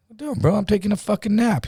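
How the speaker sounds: high, put-out complaint; irritated protest. Retold or imitated. Woken napper to his cellmate, irritated. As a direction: imitate someone annoyed at being woken.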